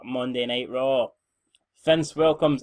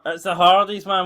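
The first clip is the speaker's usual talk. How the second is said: much louder, higher burst of excitement; excited announcement; 5 semitones higher, 7 dB louder than usual. Real, not acted. Commentator hails the surprise tag team entrance, excited.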